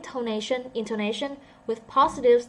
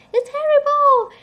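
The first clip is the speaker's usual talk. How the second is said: a sudden squeaky high wail on terrible; hammed-up misery, pitched way up and loud; mock sad voice; 13 dB louder than usual. Shown as a demonstration. Teacher acting out a sad reading for students, hammed-up wail. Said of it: "You're gonna say".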